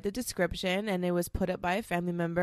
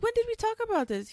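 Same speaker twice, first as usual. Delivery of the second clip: much higher and fast, incredulous; retold disbelief; faster than usual. Asking her husband, shocked, when they discussed it. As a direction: fire the question off in disbelief. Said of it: "I was like".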